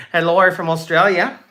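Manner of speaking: put-on playful, clumsy Australian accent; amateur Australian impression with a rising end; bad Australian accent. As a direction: greet them in a badly faked Australian accent. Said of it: "That was a bad accent".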